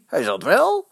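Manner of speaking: insistent shouted comeback; calling out. A narrator's reading. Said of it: "roepen de Vitessers".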